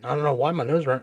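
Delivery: ordinary talk, nothing marked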